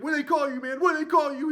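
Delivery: high, loud squeal; high squealing shout. Retold or imitated. Zed shouting at the cop in a high squeal. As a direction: squeal the question. Zed asking cop's nickname.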